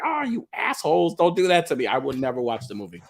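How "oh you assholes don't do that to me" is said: mock whine. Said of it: "I'd be like".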